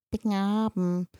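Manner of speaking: put-on a toddler mumbling one word half to himself. A narrator's reading. Little Tobias mumbling over his spinach, a lightly voiced toddler. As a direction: let the word blur out of a little boy's mouth. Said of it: "brabbelte Tobias mehr".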